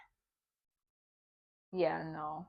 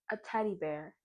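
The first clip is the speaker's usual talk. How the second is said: normal talking voice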